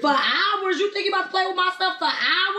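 high-pitched, indignant questioning; incredulous outrage. Real, not acted. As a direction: throw the words back in disbelief. Outraged echo of partner's words.

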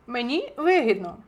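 ordinary delivery

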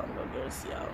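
whispered give this to y'all; whispering. Real, not acted. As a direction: breathe it, voiceless. Whispered repeat.

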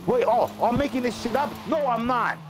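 high-pitched, indignant retort; indignant denial. Real, not acted. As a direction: throw the accusation back, voice shooting up. Arguing against being called a liar.

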